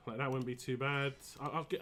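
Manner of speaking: ordinary talk, nothing marked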